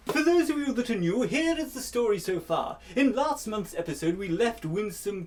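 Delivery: grand showman recap voice; hammy announcer. Acted. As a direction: recap like a melodrama host. Mock melodrama announcer recap.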